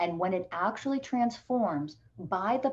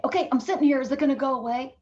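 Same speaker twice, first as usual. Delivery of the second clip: impatient beginner; 7 semitones higher, 5 dB louder, faster than usual. Retold or imitated. Mocking an impatient beginner healer.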